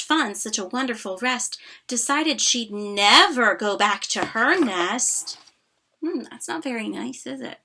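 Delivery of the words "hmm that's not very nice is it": soft aside. Real, not acted. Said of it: "soft aside to child".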